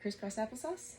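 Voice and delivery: ordinary delivery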